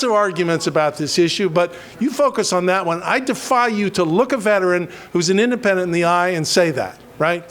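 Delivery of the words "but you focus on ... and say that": ordinary delivery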